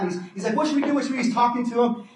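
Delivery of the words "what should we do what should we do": high urgent repeat; a boy begging friends for a plan; lightly hammed-up panic; mock panic. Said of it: "They're totally freaking out".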